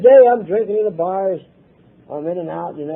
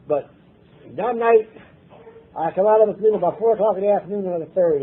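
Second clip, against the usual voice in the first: usual speaking voice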